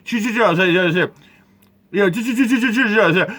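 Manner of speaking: high, machine-gun stuttered chills; mocking mimicry. Imitating the pastor shushing the woman, mocking. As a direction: parody the pastor's repeated shushing.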